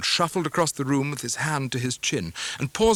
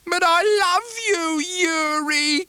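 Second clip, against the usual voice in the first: put-on much higher, louder pleading old woman; crying mother; 5 dB louder than usual. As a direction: cry out to her son, begging. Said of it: "cried Missus Heep".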